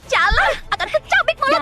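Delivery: high, enraged threat; furious threat. Acted. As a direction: spit the threat out. Threat in a quarrel.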